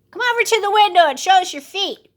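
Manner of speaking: put-on high, fast child's voice; a sharp little kid testing. A narrator's reading. Oldest kid challenging the wolf, high child voice.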